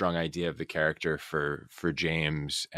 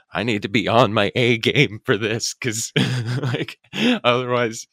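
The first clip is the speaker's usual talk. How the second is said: louder, higher, laughing through it; retold mock panic, pitched up and loud; worked-up self-talk; 6 semitones higher, 6 dB louder than usual. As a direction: psych yourself up, half-laughing under pressure. Voicing own pressured self-talk back then.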